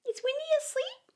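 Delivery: put-on very high little boy; high childish question; Michael's voice. A narrator's reading. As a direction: ask it like a small child.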